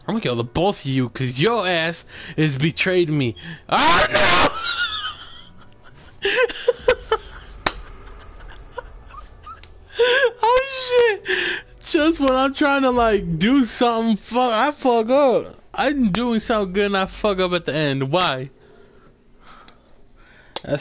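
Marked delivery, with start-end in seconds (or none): 3.7-11.6 dismayed cry. Real, not acted. Fails a move in game. 11.8-17.5 frustrated rant. Real, not acted. Frustrated over his mistake.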